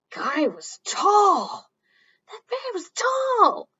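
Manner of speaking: slow loud high wonder; amazed. Acted. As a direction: marvel at how tall he is. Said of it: "a really tall guy".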